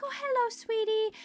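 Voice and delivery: high, cooing hello to the dog; sweet lady's voice. Retold or imitated. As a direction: greet the dog like a charmed lady. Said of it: "she was like".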